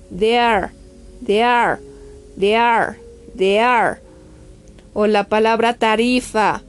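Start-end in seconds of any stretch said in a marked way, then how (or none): 0.1-4.0 "dare" said DE-ar. Shown as a demonstration. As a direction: model "dare" with an E-A glide before the R. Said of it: "pero se va a pronunciar". ("dare" pronunciado de-ar, con E A)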